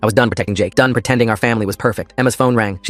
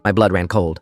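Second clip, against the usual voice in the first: ordinary delivery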